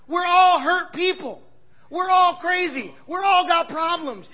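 fiery sermon punch on all; preaching with force. Acted. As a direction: pound every all. Preacher building to climax.

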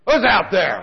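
alarmed call toward the living room; startled call out. Retold or imitated. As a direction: shout into the dark room. Startled by an intruder at night.

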